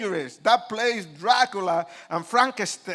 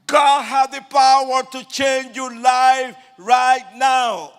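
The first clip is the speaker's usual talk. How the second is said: loud, slow, high-pitched proclamation; screaming preacher; 6 semitones higher, 10 dB louder, slower than usual. Retold or imitated. Street preacher heard by three hundred people, screaming. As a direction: belt it out over a crowd. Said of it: "the voice of this guy so loud screaming".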